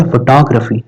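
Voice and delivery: फोटोग्राफी as fuh-TOG-ruh-fee; correct pronunciation demo. Shown as a demonstration. Teacher correcting students, फोटोग्राफी as fuh-TOG-ruh-fee. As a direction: stress TOG in फोटोग्राफी, reduce "graa" to "gruh". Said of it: "क्या बोलेंगे".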